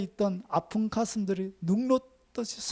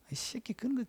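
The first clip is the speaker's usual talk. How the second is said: a hint of a gruff gangster boss, much softer; gangster-like pastor; 13 dB quieter than usual. Preacher imitating a Gyeongsang pastor scolding his assistant, softly gruff. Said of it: "막 이렇게 해요".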